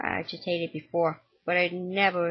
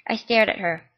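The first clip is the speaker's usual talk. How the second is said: plain reading voice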